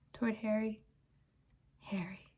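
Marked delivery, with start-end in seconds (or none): 1.8-2.3 breathy whispered name. Acted. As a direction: whisper it pleadingly. Pettigrew whispers to Harry.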